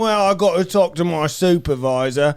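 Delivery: high, loud self-important Cockney; Cockney bouncer. Retold or imitated. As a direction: lay down the law as a Cockney lead bouncer. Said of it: "Cockney British".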